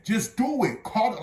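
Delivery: loud, high, exasperated order; mad, urgent command retold at full push; retold anger. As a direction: re-enact losing your temper, voice jumping up. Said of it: "Like, I was mad".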